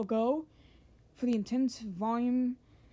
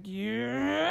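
drawn-out "yeah" rising steadily in pitch; long power-up yell; 3 semitones higher than usual. Acted. Base form powering up, yelling as it transforms. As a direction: stretch it into a climbing roar. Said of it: "It's like, all like".

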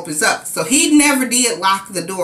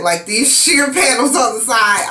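usual speaking voice

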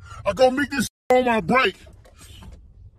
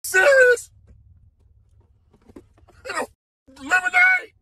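shocked disbelief; 6 semitones higher than usual. Real, not acted. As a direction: squeal in outraged shock. Finding lemonade in the box.

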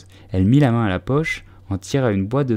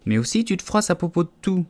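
put-on high-pitched girlish reproach; raised, defensive voice; plaintive protest; 9 semitones higher than usual. A narrator's reading. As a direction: complain as a wronged little girl. Alice protests in the quarrel.

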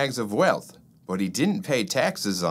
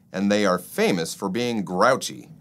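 ordinary delivery

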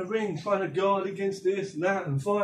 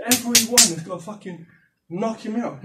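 ordinary talk, nothing marked

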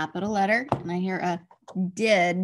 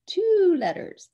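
eager cue; 10 semitones higher, 6 dB louder, slower than usual. Shown as a demonstration. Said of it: "cueing the child".

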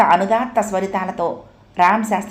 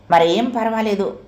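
ordinary delivery, nothing marked

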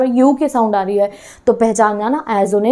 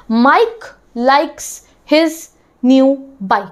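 teacher spacing out each word to show the k sound; 2 semitones higher, much slower than usual. Shown as a demonstration. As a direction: read Mike likes his new bike one word at a time, pausing between them. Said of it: "के sound ठीक है".

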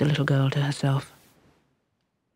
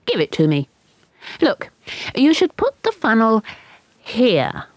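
put-on bossy, scornful child voice, pitched higher; scornful girl; 8 semitones higher than usual. A narrator's reading. Ina to the little boy, scornfully bossy. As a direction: show him how, with scorn. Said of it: "said Ina scornfully".

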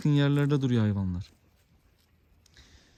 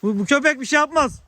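high, loud call to passers-by; 13 semitones higher, 6 dB louder than usual. Real, not acted. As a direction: raise your voice to reassure someone at a distance. Reassures passers-by at a distance. (uzaktakine yüksek sesle seslenme)

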